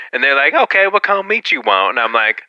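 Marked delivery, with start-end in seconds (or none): 0.5-2.0 raised-pitch sandwich worker voice; imitated sandwich worker. Sandwich worker asking a customer for an order, imitated higher voice. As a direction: put on a higher voice for the server.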